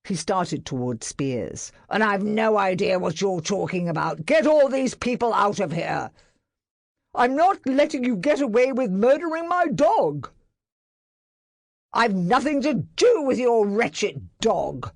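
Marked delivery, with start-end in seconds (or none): 1.9-6.1 louder, higher curt dismissal; angry dismissal. A narrator's reading. Barrett dismissing master, angry. 7.1-10.3 high, loud furious vow. A narrator's reading. Master confronting Barrett over dog, furious. 11.9-14.9 loud, higher sneer. A narrator's reading. Barrett sneering at master. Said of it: "Barrett sneered".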